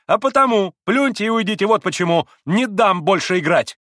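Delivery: high, loud outburst; vehement insistence. A narrator's reading. Kolganov refusing to let Mitya play, loud insistence. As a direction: hammer out each exclamation.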